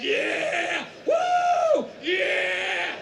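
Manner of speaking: loud, high cheering; sugar rush whoop. Acted. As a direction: whoop like a party crowd.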